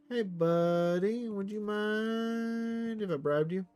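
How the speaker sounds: slow, high, syrupy hello; butter him up before the ask; drawn-out fake-sweet greeting to a rival ruler; mock-friendly greeting. Sweet-talking a bribe.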